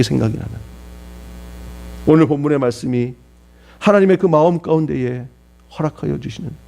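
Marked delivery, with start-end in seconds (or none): none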